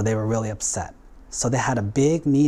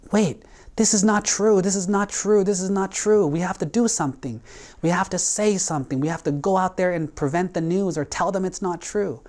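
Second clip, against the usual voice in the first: high, urgent, insistent protest; higher-pitched, alarmed and pleading to be heard; upset protest; 7 semitones higher than usual. Retold or imitated. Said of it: "he was very angry".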